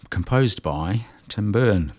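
ordinary talk

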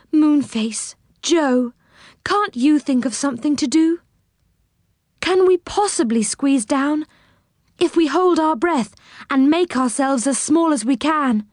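lightly voiced appeal for help; pleading. A narrator's reading. Silky asking friends for a way down, mildly pleading.

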